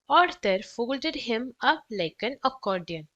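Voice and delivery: ordinary delivery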